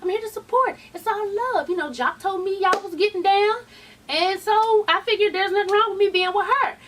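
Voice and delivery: lightly hammed-up peacemaker; mock smooth boyfriend. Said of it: "He was like".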